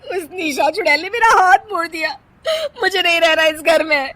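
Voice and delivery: loud, high, tearful wail; crying complaint. Acted. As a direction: sob to your husband. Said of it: "रोते हुए". (पति से रोते हुए शिकायत)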